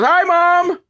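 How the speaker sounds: high, loud mock greeting; calling out. Son spots mom being interviewed, imitated loud call. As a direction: holler it like an embarrassing kid.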